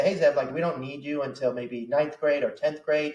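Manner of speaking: usual speaking voice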